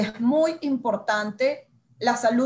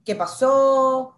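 bewildered; 3 semitones higher, 5 dB louder, much slower than usual. Retold or imitated. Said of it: "De verdad que tú dices".

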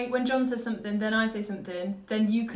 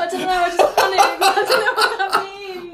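high, loud protest through real laughter; helpless laughter; 9 semitones higher, 9 dB louder than usual. Real, not acted. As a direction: plead ignorance while cracking up. Cracking up at own nonsense word.

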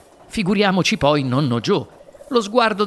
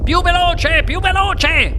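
high, loud, repeated command; frenzied call for more speed; urgent order; 11 semitones higher, 4 dB louder, slower than usual. A narrator's reading. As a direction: urge them on excitedly at high pitch. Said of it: "ordinò il signor Wonka".

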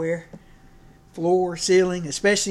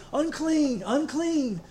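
higher, drawn-out mock call of unclean; leper's cry; 9 semitones higher, much slower than usual. A leper warning people off, higher mock call. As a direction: chant it as a warning to all. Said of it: "'cause they're supposed to say".

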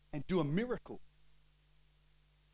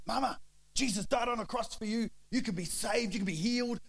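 loud, higher-pitched fervent plea; zealous convert; 7 semitones higher, 4 dB louder than usual. Retold or imitated. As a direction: re-enact urgent preaching at your mother. Retelling himself newly saved, preaching to mum.

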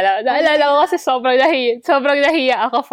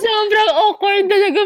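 laughing; 10 semitones higher, 4 dB louder than usual. Real, not acted. Laughing while recalling awkwardness.

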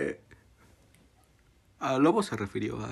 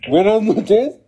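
loud, overly formal call-center voice; fake bank operator; 9 dB louder than usual. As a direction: play a stiff bank clerk on the phone.

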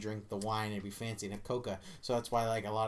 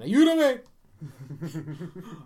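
long drawn-out, louder movie line; movie impression; 5 semitones higher, 9 dB louder, much slower than usual. Quoting someone from a movie, drawn-out impression. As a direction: stretch it out in a put-on film voice. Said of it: "from a movie".

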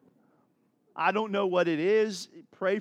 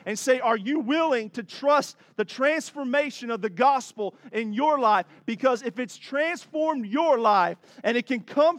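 louder, rising preacher's call; full-force preaching; 4 semitones higher, 6 dB louder than usual. Acted. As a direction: drive it home to the congregation. Preaching builds to climax.

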